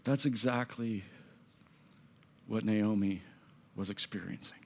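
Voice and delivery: soft and low, with long pauses; hushed, aching. Acted. Preacher linking a grieving sister's wish to Naomi, hushed. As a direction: let the wish sink into near silence.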